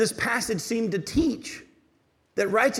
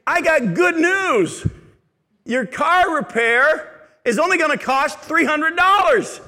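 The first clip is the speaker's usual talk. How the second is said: louder, higher, slow cheery announcement; mechanic's good news; 7 semitones higher, 6 dB louder, slower than usual. Retold or imitated. Mechanic cheerfully telling the customer the cost, lightly voiced. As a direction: play the upbeat mechanic, lightly. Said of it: "my mechanic saying".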